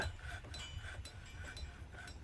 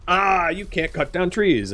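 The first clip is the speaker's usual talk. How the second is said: higher, slow drawn-out mock objection; mock the caravan with a drawn-out, higher voice; complaining caravan; 6 semitones higher, slower than usual. Caravan objecting to the tree cutting, mock complaint. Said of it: "this caravan's gonna go".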